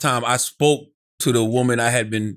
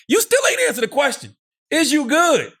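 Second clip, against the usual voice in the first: high heated exchange; 13 semitones higher than usual. Retold or imitated. Heated quoted exchange on the phone.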